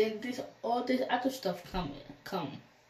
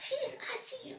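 high, soft stunned question; a hushed, high disbelieving echo; disbelief; 8 dB quieter, much faster than usual. Retold or imitated. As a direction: ask it high and breathless with shock. Disbelief at ICU news.

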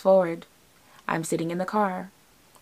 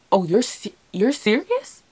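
halting, pitch leaping up into a question; stumble, then ask "serious" high in disbelief; incredulous question; 4 semitones higher than usual. Retold or imitated. Girlfriend realizing her boyfriend's proposal is real, high incredulous question.

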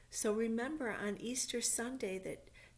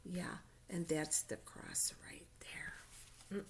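whisper; 2 semitones lower, 7 dB quieter, slower than usual. Real, not acted. Pointing at picture for kids.

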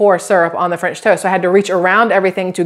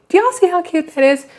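cutesy high gush; 9 semitones higher than usual. Real, not acted. Showing off new cup.

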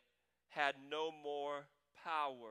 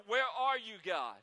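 louder, higher cry of where are you; pleading cry; 7 semitones higher, 9 dB louder than usual. Acted. Pastor voicing a believer face down before the Lord, pleading cry. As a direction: call out to an absent God. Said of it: "we're just on our face before the Lord".

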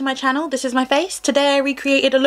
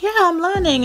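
higher-pitched mock chorus of learners; imitating everyone; 9 semitones higher than usual. Quoting newly keen home cooks, imitated in a higher voice. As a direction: mimic people announcing their new hobby. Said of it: "everyone's like".

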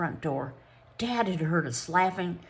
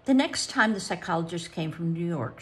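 ordinary delivery, nothing marked

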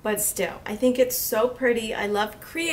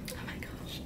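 awed whisper; 18 dB quieter than usual. Real, not acted. Awed at the palette.

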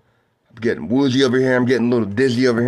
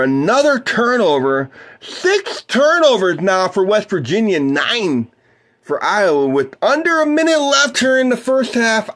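play-by-play; 7 semitones higher than usual. Acted. Calls the game for listeners.

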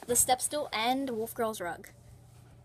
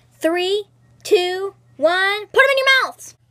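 building countdown, ending in a yell; excited countdown shout; 6 semitones higher, 10 dB louder, slower than usual. Real, not acted. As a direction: count down louder and louder, then shout. Countdown before eating candy.

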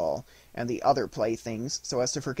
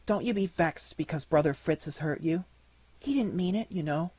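put-on higher, gentle child's voice; soft little girl; 5 semitones higher than usual. Marie soothing wounded Nutcracker, lightly voiced tender girl. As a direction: comfort the hurt toy tenderly. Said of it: "said she very softly".

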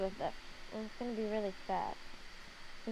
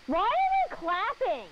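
surprised; 10 semitones higher, 6 dB louder, much slower than usual. Real, not acted. Hears clapping through headphones.

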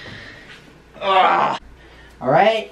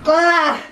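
sharp shocked yelp; yelp from the cold; 8 semitones higher, 4 dB louder than usual. Real, not acted. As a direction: cry out at the icy water. Plunging into ice bath.

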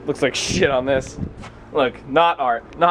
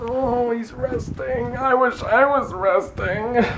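mock envy; 9 semitones higher, slower than usual. Mocking dad resting.

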